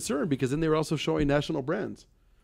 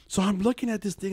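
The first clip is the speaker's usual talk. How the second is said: usual speaking voice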